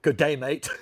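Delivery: hammed-up Aussie greeting, loud and laughing; mock Australian. Greeting an Australian viewer in mock Australian. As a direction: throw on a cartoon Aussie accent. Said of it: "Oh, you're Australian?".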